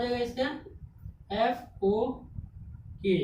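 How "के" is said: ordinary delivery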